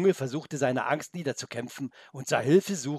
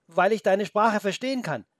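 put-on boy's voice; 8 semitones higher, 6 dB louder than usual. Boy Felix answers wolf.

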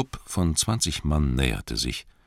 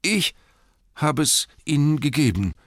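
high, halting little peep; timid squeak; 11 semitones higher, 4 dB louder than usual. A narrator's reading. Bilbo confesses to furious Thorin, squeaking. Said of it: "piepste Bilbo".

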